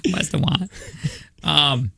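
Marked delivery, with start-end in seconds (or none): 0.1-0.7 laughing Westawant, W for R; R-lisp Westawant. Shown as a demonstration. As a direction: say Westawant like a lisping kid. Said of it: "how other normal people say restaurant".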